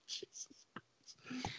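slow exasperated whisper; hushed disbelief. Real, not acted. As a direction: breathe it out in disbelief. Exasperated aside.

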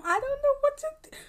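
very high helpless voice; flustered in a very high voice; helpless squeak. Retold or imitated. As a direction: squeak it out, overwhelmed. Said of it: "and I was just like".

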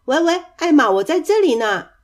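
high, loud hallo from hiding; calling out. A narrator's reading. Hidden cousin teasing Elmer, calling out loudly. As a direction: call out brightly to be found.

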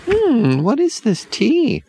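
put-on high suspicious child. A narrator's reading.

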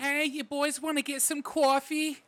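put-on high, slow Jersey girl squawk; Jersey girl accent. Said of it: "really loud, annoying Jersey girl accent".

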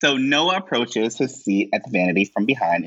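ordinary talk, nothing marked

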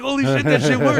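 loud, high burst of retold astonishment; amazed excitement. Rapper finds lawyer's bluff worked, loudly amazed.

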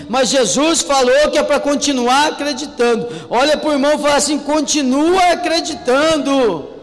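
loud, high fervent proclamation; preaching at full force. Real, not acted. Preacher urging the church, loud and fervent. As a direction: shout the promise to the church. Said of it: "preacher building to climax".

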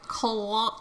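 very soft, hesitant "Claw"; child copying dad. Retold or imitated. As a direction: imitate a small boy's quiet "Claw". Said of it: "And A J's like".

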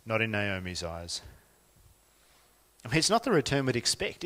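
normal talking voice

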